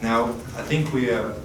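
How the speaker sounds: ordinary talk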